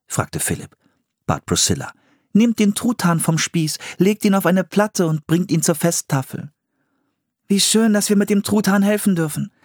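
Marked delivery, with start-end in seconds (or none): 2.3-6.5 high-pitched storybook character voice; higher woman's voice, directing helpers; woman giving instructions. Acted. 7.5-9.5 put-on high-pitched, delighted girl; eager child character, thrilled to help; enthusiastic. A narrator's reading. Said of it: "sagte Anne begeistert".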